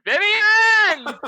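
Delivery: very loud, high yell; distant shout. Acted. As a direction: scream the name across the dreamscape. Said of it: "all of a sudden you hear".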